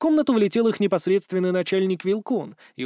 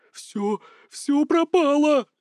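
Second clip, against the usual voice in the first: high, slow, quavering wail; trembling despair; 9 semitones higher, 3 dB louder, much slower than usual. Acted. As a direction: wail like all is lost. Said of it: "трясся Иркат". (дрожащий отчаянный вопль)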